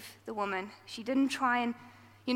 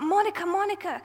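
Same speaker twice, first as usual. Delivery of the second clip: higher, louder pleading cry; shouted plea to the angel; retold shout; 6 semitones higher, 4 dB louder than usual. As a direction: call out to Monica, afraid. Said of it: "she shouts out".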